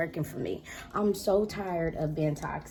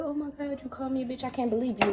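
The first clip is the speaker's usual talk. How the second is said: mock wounded; 7 semitones higher, faster than usual. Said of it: "I'm not the person that be like".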